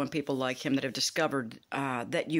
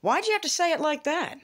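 indignant question jumping much higher; outraged, pitched way up; shocked disbelief; 12 semitones higher than usual. Retold or imitated. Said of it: "And I was just like".

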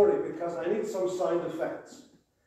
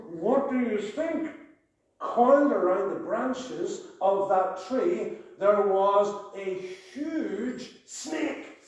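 dramatic storytelling; 8 semitones higher than usual. Acted. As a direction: build the danger for the children. Builds suspense in children's story.

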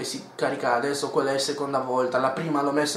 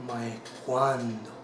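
dramatic deep line; 5 semitones lower, 6 dB quieter than usual. Acted. Game phrase in dramatic voice.

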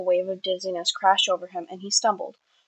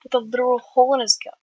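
fretting; 4 semitones higher, 5 dB louder than usual. A narrator's reading. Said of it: "Wilbur fretted".

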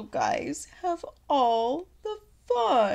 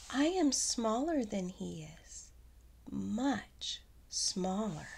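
whispered, much softer; scared whisper; 3 semitones lower, 13 dB quieter than usual. Acted. Gerald whispers fearfully about the big guy. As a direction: shrink into a whisper.

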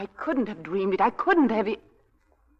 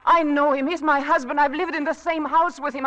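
distraught outburst; 6 semitones higher, 7 dB louder than usual. Acted. Wife defending husband, distraught.

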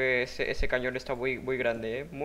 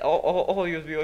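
surprised; 3 semitones higher, 5 dB louder than usual. Real, not acted. Sudden reaction to strange build.

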